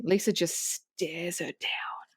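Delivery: slow, whispered menace; menacing whisper. Retold or imitated. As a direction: let the threat sink into a slow whisper. Narrating a menacing stare-down.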